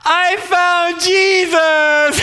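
very slow, high, loud, laughing and weeping announcement; tearful joy. Retold or imitated. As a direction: proclaim it through tears of joy. Friend's big announcement.

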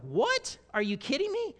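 high, loud incredulous cry; astonished disbelief. Pretend. The righteous answering the king, high and astonished. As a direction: gasp out total disbelief. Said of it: "They're like".

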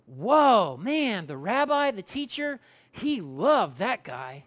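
slow, wowed reaction; drawn-out awe, opening loud on WHOA; amazed onlookers. Acted. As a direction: marvel like a stunned bystander. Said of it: "friends who were gathered to go".

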